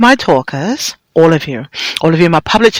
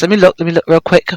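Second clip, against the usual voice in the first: ordinary talk, nothing marked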